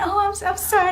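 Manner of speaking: apologetic Michael. Retold or imitated. Michael apologizing to the speaker, high and hurried. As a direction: soften it like Michael backpedaling. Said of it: "He said".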